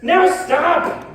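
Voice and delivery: stern command. Acted. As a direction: cut them off like a king. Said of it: "Max said".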